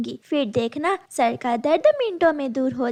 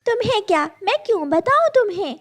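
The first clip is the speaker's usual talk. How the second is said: defiant; 9 semitones higher, much faster than usual. Acted. Girl snaps at crow.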